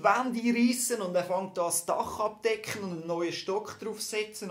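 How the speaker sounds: excited build. Real, not acted. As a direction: pile it up with rising excitement. Preacher builds excited list.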